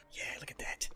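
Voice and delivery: whisper. Real, not acted. Whispered boast.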